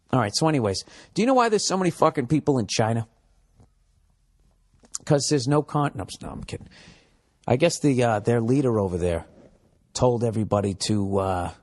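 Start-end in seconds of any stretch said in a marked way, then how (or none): 6.0-6.7 breathy whispered retraction; whispered aside. Real, not acted. Comedian retracting an edgy joke, whispered aside. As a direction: take it back under your breath.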